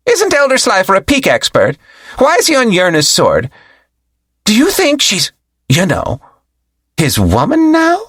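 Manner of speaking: high curious gossip voice; gossiping disciple. Acted. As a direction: lean in and gossip.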